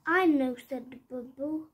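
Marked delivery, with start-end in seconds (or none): 0.1-0.6 eager answer. Acted. Bubble's sudden idea.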